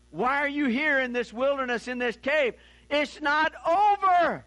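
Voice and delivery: swelling from urgent questions into a booming proclamation; God's voice. Retold or imitated. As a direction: build God's question until it bursts into "It's not over". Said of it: "In other words".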